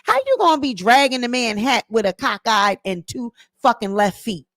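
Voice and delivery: ordinary delivery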